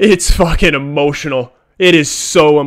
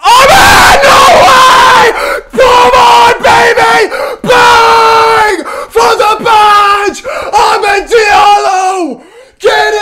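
much higher and louder, stretched out slowly; ecstatic scream; 7 dB louder, much slower than usual. Real, not acted. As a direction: scream the winner in disbelief. Fan screaming at winning goal.

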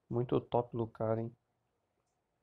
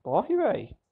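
high, loud yell to run; excited yell; 9 semitones higher, 7 dB louder, slower than usual. Real, not acted. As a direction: shout it mid-fight, urgent. Gamer yelling during fight. (grito animado)

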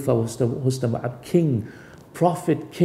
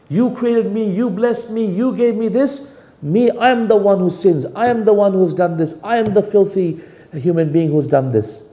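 humble pleading supplication; 7 semitones higher than usual. Shown as a demonstration. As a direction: plead up high and urgent, confessing. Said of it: "When you speak like this".

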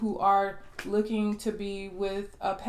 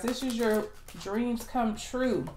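ordinary delivery

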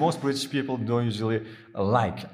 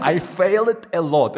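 laughing; 5 semitones higher, 7 dB louder than usual. Real, not acted. Laughs at own failures.

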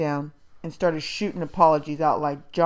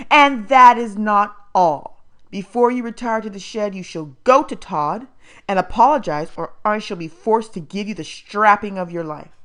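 threatening foster mother; 2 semitones higher, 7 dB louder than usual. A narrator's reading. Missus Amos threatens strapping.

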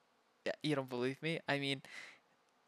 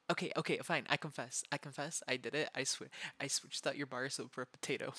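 usual speaking voice

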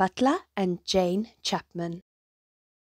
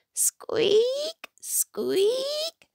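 high thin creaky squeak; little creaking squeaks; squeaking lanterns. A narrator's reading. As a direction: imitate swinging lanterns squeaking. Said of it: "went the lanterns".

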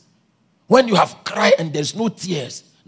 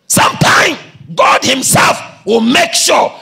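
slow, raised preacher's shout; emphatic preaching; 6 semitones higher, 6 dB louder, slower than usual. Real, not acted. Preacher to church on God's hidden plan, emphatic preaching. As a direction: drive it home to the congregation.

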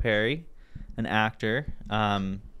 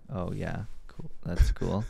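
low-key monotone approval; mean it, but sound like mockery; unintentionally sarcastic; 3 semitones lower, slower than usual. Monotone talker reacting to someone's news, sounding sarcastic. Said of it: "think I'm being sarcastic".

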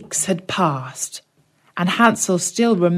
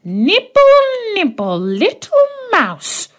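very high, slow, shrill crone's sing-song; shrill witch voice; 13 semitones higher, 3 dB louder than usual. Acted. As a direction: croon it like a hidden old witch. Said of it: "a shrill voice came out".

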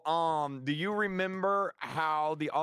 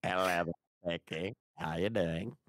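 much lower silly duck voice; 9 semitones lower, slower than usual. Pretend. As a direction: put on a goofy duck voice. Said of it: "Why do you sound like a duck?".

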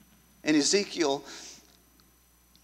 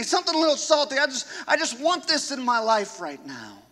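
quick, wheedling offer of a better deal, rising higher; tempting voice; 9 semitones higher, 3 dB louder than usual. Acted. Preacher voicing the tempter's offer to his congregation, a wheedling quick pitch. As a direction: coax like a smooth salesman.

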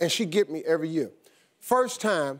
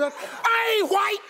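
high, drawn-out comic wail; panicked protest; 14 semitones higher, much slower than usual. Retold or imitated. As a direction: cry out in mock desperation, stretched and high. Panicked comeback to wife.

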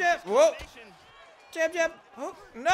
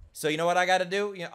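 ordinary talk, nothing marked

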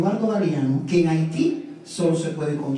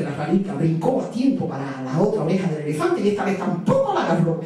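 ordinary delivery, nothing marked

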